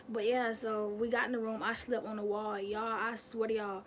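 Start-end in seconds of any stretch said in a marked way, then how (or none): none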